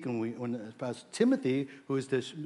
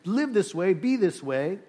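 raised, louder preacher giving orders; mock commanding; 5 semitones higher, 5 dB louder, slower than usual. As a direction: instruct forcefully, higher and louder.

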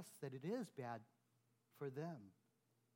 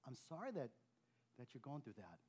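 much softer, tender acknowledgement; gentle sympathy; 4 semitones lower, 6 dB quieter than usual. Shown as a demonstration. Pastor modelling comfort for someone in pain, softly. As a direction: say it softly, with care. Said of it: "first acknowledge their pain".

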